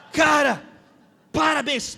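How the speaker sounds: loud, high-pitched praise; exuberant congratulations. Retold or imitated. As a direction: shout how proud you are, retelling it. Repeats congratulation to pilot.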